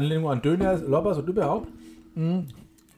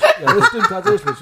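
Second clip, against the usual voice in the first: high laughing reply, much louder; 9 dB louder than usual. Retold or imitated. Own retold reply, laughing.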